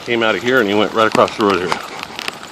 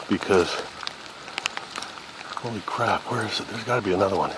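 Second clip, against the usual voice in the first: hushed; 12 dB quieter than usual. Real, not acted. Spotting deer, hushed.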